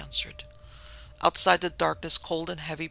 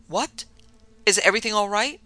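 higher, raised questioning voice; urgent demand; 5 semitones higher, 7 dB louder than usual. A narrator's reading. Servant to the doctor after pounding, urgently demanding. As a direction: demand an answer, pitched up in alarm. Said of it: "demanded Corral".

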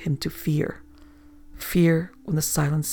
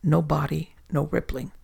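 usual speaking voice

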